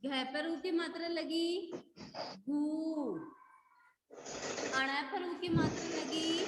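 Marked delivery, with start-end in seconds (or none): 2.5-3.2 "घू" drawn out as ghooo; long vowel. Shown as a demonstration. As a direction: hold the u in "घू" long. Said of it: "लंबा हो रहा है ना".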